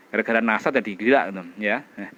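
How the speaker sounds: ordinary talk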